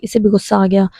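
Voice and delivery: usual speaking voice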